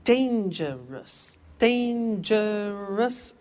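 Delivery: Dangerous drawn out very slowly, DAN-ger-ous; exaggerated slow teacher model; slow syllable demo. Shown as a demonstration. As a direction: spell out Dangerous beat by beat. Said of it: "How many?".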